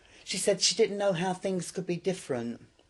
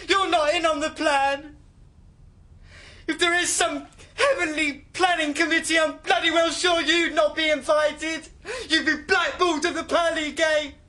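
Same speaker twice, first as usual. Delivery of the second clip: furious high-pitched shouting tirade; loud, high, wounded rant; angry outburst; 10 semitones higher, 6 dB louder than usual. Acted. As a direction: shout it in outraged, tearful fury. Rant at the priest in thought.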